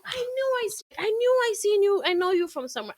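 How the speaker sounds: high-pitched, eager mock stranger; imitated excited recognition. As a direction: blurt it like spotting someone half-famous. Acting out strangers recognizing her.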